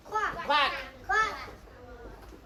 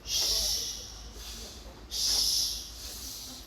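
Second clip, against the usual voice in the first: shushing; 9 dB quieter, much slower than usual. Shown as a demonstration. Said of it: "shushing demo".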